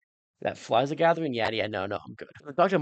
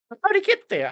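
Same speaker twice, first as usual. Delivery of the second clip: surprised; 7 dB louder than usual. Real, not acted. Puzzled at monster reappearing.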